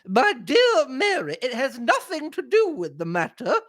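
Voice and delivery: hammed-up high-pitched indignant denial; Missus Inglethorpe. A narrator's reading. Acted quarrel, old lady refuses.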